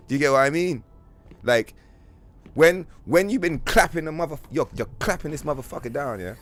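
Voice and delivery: ordinary delivery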